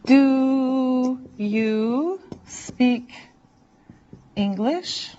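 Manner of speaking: each word drawn out, very slow, with a pause before English; very slow model. Shown as a demonstration. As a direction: stretch it out for learners. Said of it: "how you say it".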